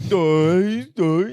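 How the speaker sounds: high, loud dopey cartoon voice; goofy cartoon voice. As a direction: play a dim-witted candy mascot. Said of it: "He's always like".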